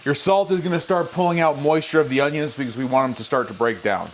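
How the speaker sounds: ordinary talk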